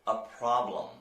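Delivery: normal talking voice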